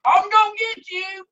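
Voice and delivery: very high cartoonish holler; mock chasing holler. Short fat guy chasing the dog with a stick, cartoonish holler. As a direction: call after it high, like a comic pursuer.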